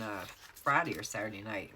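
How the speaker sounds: ordinary delivery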